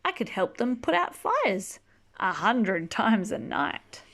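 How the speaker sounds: ordinary delivery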